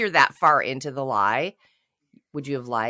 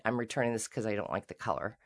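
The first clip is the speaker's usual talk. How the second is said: softer, quick offhand excuse; a softer, lightly voiced customer; casual return excuse; 6 semitones lower, 9 dB quieter than usual. Shown as a demonstration. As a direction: toss it off lightly. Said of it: "and said".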